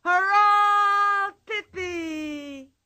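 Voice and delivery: high, loud crowd cheer; crowd shouting. Acted. Audience throwing hats and cheering, shouting. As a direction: roar it out like a circus crowd.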